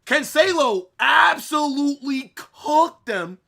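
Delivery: loud, hyped reaction to the goal; excited shout. Real, not acted. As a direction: yell it out, thrilled.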